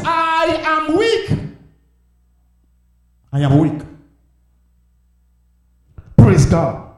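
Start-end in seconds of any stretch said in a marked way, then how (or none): 0.0-1.4 high, long-drawn, almost chanted cry; preached cry of weakness. Acted. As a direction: stretch I AM WEAK out high and slow. Preacher cries out David's confession.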